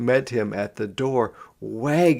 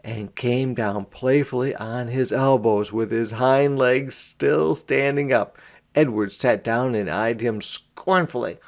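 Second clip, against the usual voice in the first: plain reading voice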